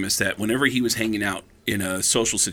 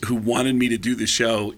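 ordinary delivery

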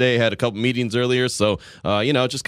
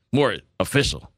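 ordinary talk

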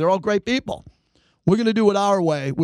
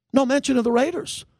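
higher, rising in disbelief; incredulous; 7 semitones higher than usual. Retold or imitated. Host telling listeners about the snub, rising incredulous. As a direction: throw it up as if you can't believe it. Host recounting watching the preview.

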